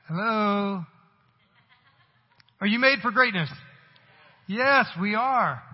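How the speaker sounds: loud, high, slow-landing "Yes, we are"; preaching shout. Acted. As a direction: rouse the whole room. Preacher rouses congregation.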